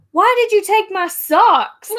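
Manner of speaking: hammed-up dad demanding an answer; imitating dad accusing. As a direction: accuse like an outraged dad, playfully. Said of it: "he looked at me, he said".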